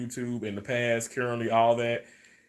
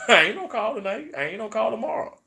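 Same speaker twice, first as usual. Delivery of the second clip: sassy refusal; 9 semitones higher, 4 dB louder than usual. Retold or imitated. Defiant reply to callers, laughing.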